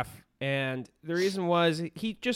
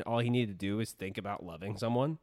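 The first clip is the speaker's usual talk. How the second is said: ordinary delivery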